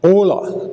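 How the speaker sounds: Ola said high with the first syllable dropped, OH-lah; Ola, lifted high, Vi dropped; Gullah name Ola. Shown as a demonstration. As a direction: clip Viola down to Ola. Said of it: "not Viola".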